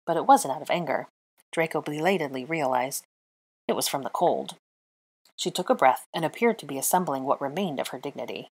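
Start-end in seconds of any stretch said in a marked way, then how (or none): none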